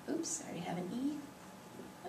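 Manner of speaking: hushed, whispery self-correction; barely audible oops to oneself; whispered aside. Real, not acted. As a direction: whisper it to yourself, very softly. Mutters about own slip while writing.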